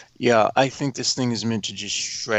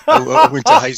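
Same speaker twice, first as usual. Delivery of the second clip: laughing; 12 dB louder, much faster than usual. Real, not acted. Laughing while starting story.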